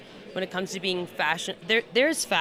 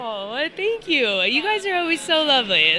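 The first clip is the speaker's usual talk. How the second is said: gushing red carpet chatter; 6 semitones higher, 5 dB louder than usual. Real, not acted.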